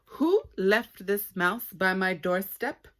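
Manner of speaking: small dog shouting at the bushes; calling out loudly. Acted. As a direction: bellow it to the whole courtyard. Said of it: "called out as loudly as she could".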